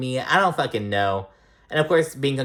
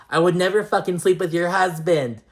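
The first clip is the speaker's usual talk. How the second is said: imitating an upset woman, pitched up; higher, put-on crying indignation; mock tearful protest; 6 semitones higher, 3 dB louder than usual. Cast member crying at her friend over the husband accusation, mock tearful. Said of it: "she's crying still".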